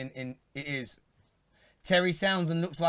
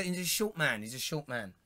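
ordinary talk, nothing marked

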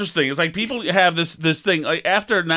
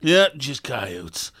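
put-on much lower, slow, softer old-timer drawl; weathered old camper; 6 semitones lower, 4 dB quieter, much slower than usual. Old-timer reassures the jumpy camper, low and slow. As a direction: shrug it off, slowly. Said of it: "people love to say that".

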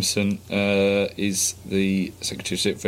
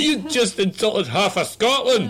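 a hint of a Scottish troll, higher and slow; mock angry Scot; 9 semitones higher, 3 dB louder than usual. Scottish tweeter scolding him, lightly mocked outrage. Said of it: "That's what I got on Twitter".

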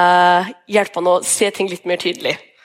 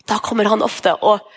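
ordinary talk, nothing marked

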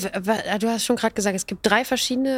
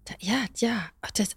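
whispering; 5 dB quieter than usual. Real, not acted. Awed reaction to scream dream.

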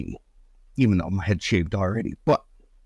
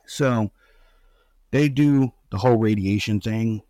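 usual speaking voice

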